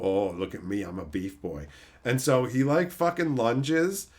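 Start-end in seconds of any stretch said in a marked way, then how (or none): 0.0-1.7 much lower, softer meathead voice; deep dumb jock voice; mock beef boy. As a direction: play a dopey vain muscle guy. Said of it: "Bellamy's one of the big beefy guys".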